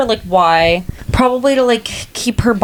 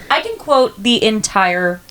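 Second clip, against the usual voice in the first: ordinary talk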